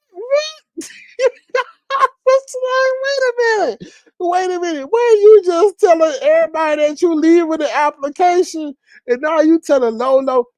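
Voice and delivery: very high-pitched mock disbelief; high, comic "hold on" protest; incredulous objection. Retells own incredulous objection to her.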